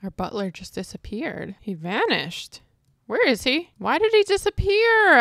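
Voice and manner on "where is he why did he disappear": surprised. Real, not acted. Butler vanished, she's baffled.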